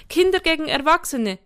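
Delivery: put-on high, loud child laying down terms; bossy decree. A narrator's reading. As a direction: declare it like a small boy in charge. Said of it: "bestimmte Jafet".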